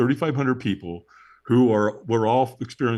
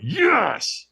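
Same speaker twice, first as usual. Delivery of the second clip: stronger yes; 10 semitones higher than usual. Retold or imitated. Answering the trainer's call for a stronger yes. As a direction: push the yes up harder. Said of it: "And I went".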